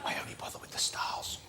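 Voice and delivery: hushed, slow aside whisper; conspiratorial whisper. Acted. Host jokes to audience about crew, whispered aside. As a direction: let the audience in on a secret, whispered.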